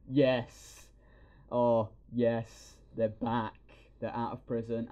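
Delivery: loud, joyful yes; excited cheering. Retold or imitated. Fan cheering the heroes returning, loud and joyful. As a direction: cheer like your team is back. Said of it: "it's like".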